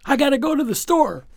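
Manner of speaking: put-on high, fast child's voice; a girl blurting an excuse and dashing off; kid hurrying off. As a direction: rush the excuse like a kid eager to leave. Kid hurrying away from questions.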